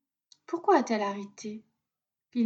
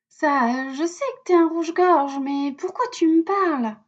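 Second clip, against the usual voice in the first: put-on little girl voice; 5 semitones higher, 5 dB louder than usual. A narrator's reading. Lila answering the robin.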